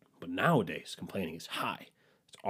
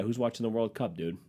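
ordinary delivery

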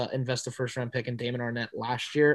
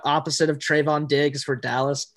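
ordinary talk

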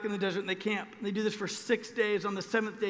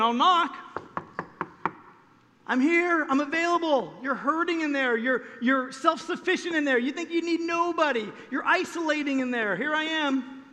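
higher, louder, fast earnest appeal; urgent God's plea; 6 semitones higher, 3 dB louder than usual. Retold or imitated. God knocking at the heart's door, urgent and earnest. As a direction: press the invitation quickly. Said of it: "He's saying".

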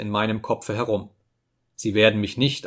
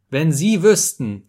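louder, slower, higher repeat of wenn Sie wüssten; emphatic plea; 5 semitones higher, 6 dB louder than usual. Acted. Narrator pleading with Simono, repeating it louder and slower. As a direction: lean hard into the second wenn Sie wüssten.

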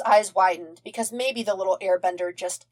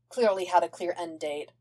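ordinary delivery

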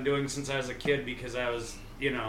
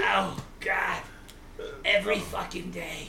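Mort voice; 3 semitones higher than usual. Pretend. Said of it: "You sounded like that dude from fucking Family Guy".

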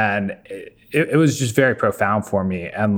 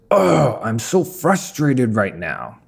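put-on frustrated little kid; 3 semitones higher, much slower than usual. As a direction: play a frustrated six-year-old, drawing the words out. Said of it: "the kid was really frustrated".

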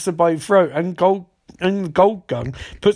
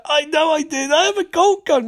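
much higher, squeaky sister voice; high squeaky Lisa; 12 semitones higher than usual. Retold or imitated. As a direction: squeak it out defensively. Lisa's squeaky defensive reply.

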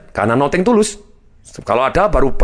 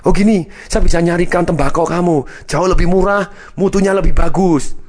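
put-on raised, emphatic young hopeful's pitch; eager sales pitch; 7 semitones higher than usual. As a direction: sell it eagerly to the boss. Young man pitches to tobacco king. (menirukan anak muda menawarkan dengan semangat)

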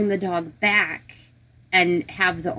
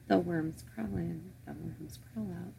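creepy near-whispered chant; eerie hushed rhyme; 3 semitones lower, 16 dB quieter than usual. Acted. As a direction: murmur it like a spooky nursery rhyme. Chanting the old burial rhyme.

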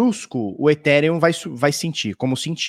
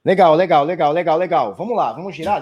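ordinary talk, nothing marked

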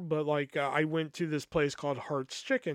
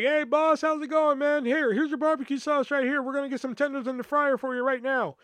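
much higher, louder friendly server voice; cheery staff greeting; 10 semitones higher, 4 dB louder than usual. Retold or imitated. Chicken place worker greeting a regular, high cheery welcome. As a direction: greet him like a regular at the counter.

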